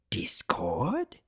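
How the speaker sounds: a stunned whispered name. Acted. Centaur recognizing the chaos spirit, whispered. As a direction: breathe the name in disbelief. Startled recognition at his bars.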